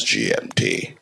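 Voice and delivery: very low, booming "G M T"; deep mock announcer. Comic mocking the BBC time call, very deep voice. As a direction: drop the voice way down like a radio ident. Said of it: "it goes".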